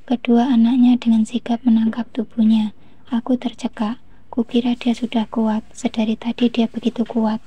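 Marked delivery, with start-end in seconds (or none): none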